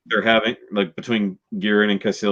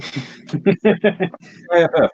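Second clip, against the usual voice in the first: very slow, higher spelled-out letters; drawn-out readout; 9 semitones higher, much slower than usual. Retold or imitated. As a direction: stretch each letter like reading a scanner. Officer reads ship ID.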